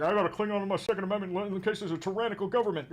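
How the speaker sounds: lightly voiced mocking MAGA gun owner; a hint of a dopey gun nut. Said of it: "chuds were always like".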